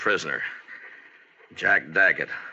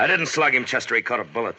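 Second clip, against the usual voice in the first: urgent shout; 6 semitones higher, 4 dB louder than usual. Acted. Gunfight, marshal calling back.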